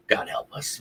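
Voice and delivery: God help us, breathed under the breath; a hushed plea; whispered prayer of a fan; whispered aside. Real, not acted. Despairing aside after a loss.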